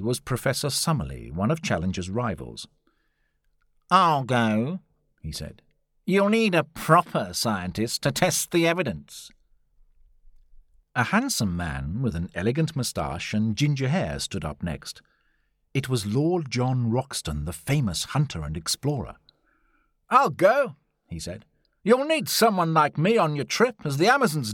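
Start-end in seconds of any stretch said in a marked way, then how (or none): 3.9-4.8 lightly voiced rival professor, a little louder; volunteer as a rival scientist; Summerlee's voice. A narrator's reading. 6.0-9.3 higher-pitched rival professor; Summerlee's voice. A narrator's reading. 20.1-20.8 high, louder keen adventurer; volunteer boldly, pitched up; Lord John's voice. A narrator's reading. Narrator voices Lord John Roxton.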